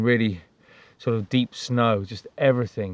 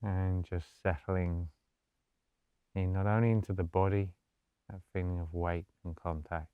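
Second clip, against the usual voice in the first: soft, low-key meditation voice with long pauses; guided meditation; 4 semitones lower, 10 dB quieter than usual. Acted. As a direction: gently guide them into stillness, hushed and unhurried.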